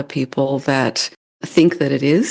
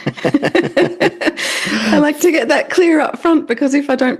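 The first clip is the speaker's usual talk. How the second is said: laughing through the words, pitched up; 12 semitones higher, slower than usual. Real, not acted. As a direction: chuckle while you explain yourself. Laughs while explaining her accent.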